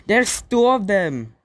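loud alarmed call; alarmed shout. Real, not acted. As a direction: shout the bad news. Two sharks appear.

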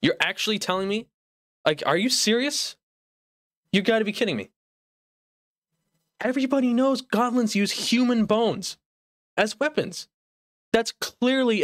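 mock disbelief. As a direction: argue as if personally affronted by it. Incredulous rant about goblin misinformation.

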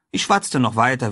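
plain reading voice

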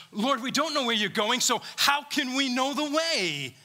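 high, pleading, slowed question; confused Thomas. Acted. Preacher voicing Thomas asking Jesus the way, confused. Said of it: "how confused Thomas is".

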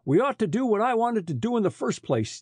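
dogged. A narrator's reading. Henchman urging his chief to act, doggedly. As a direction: press the point with understated stubbornness. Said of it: "declared Redhead doggedly".

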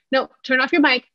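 high insistent turn off your mic; sharp order. Shown as a demonstration. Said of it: "This is what our children are hearing".

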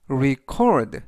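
"record" as re-CORD, weight on the O; stress on the O. Shown as a demonstration. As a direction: punch the second syllable of "record". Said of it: "이때 강세는 알파벳 O의 강세가".

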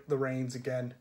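ordinary delivery

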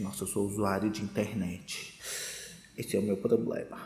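low, quiet confession voice; support group confession. Retold or imitated.

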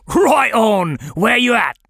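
high, loud British call; hearty rough British hail; rough British accent. Acted. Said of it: "A rough British accent".

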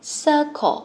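"Circle" said loud and high, two quick syllables. Shown as a demonstration. Teacher calls the word out to the class. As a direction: punch out "Circle" for the class to hear. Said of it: "Hai âm ha các con".

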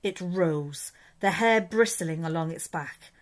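usual speaking voice

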